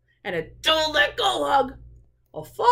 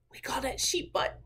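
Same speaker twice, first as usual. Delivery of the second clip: soft whispered joke; whispered aside; 9 dB quieter than usual. Real, not acted. Reader's aside to listeners.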